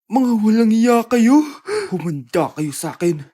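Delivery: low, slow, loud furious threat; mother furious at what she read; menacing threat. Acted. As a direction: promise payback through clenched anger. Reading phone, vowing revenge.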